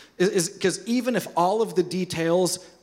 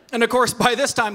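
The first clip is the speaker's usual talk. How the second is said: normal talking voice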